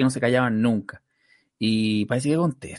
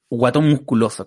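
ordinary delivery

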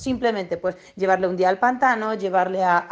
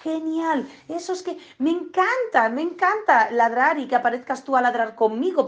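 dog's delighted voice; 5 semitones higher than usual. Retold or imitated. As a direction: voice the thrilled dog enjoying it. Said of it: "el perro dice".

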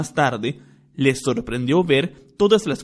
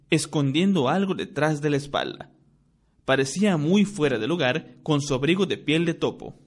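normal talking voice

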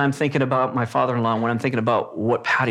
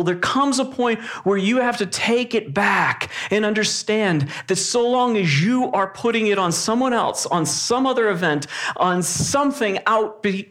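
higher-pitched, urgent preaching; earnest sermon delivery, pitched up; 6 semitones higher than usual. Acted. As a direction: press the point home from the pulpit. Raised preaching passage.

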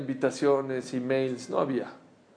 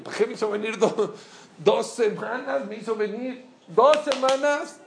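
indignant disbelief; 5 semitones higher than usual. Retold or imitated. Pupil objecting to being made to wait two weeks, indignant. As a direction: protest in high-pitched, stretched-out disbelief.

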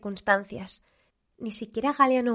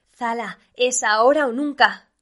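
loud, slow, emphatic urging; urgent call; 3 semitones higher, 7 dB louder than usual. A narrator's reading. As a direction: press her loudly, word by word. Colleague urging her under pressure.

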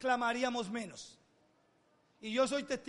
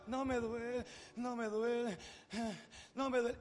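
softer, drowsy repeating of "no me duele"; soft repeated mantra; 7 dB quieter than usual. Retold or imitated. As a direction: lull yourself to sleep with it. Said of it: "Y yo empezaba". (niño que se calma repitiendo bajito)

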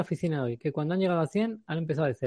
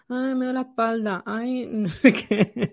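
whiny excuses; 7 semitones higher, 4 dB louder, slower than usual. Retold or imitated. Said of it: "el resto".

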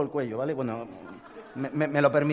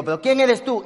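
heated demand; 8 semitones higher, 8 dB louder than usual. Retold or imitated. Heated intercom argument.

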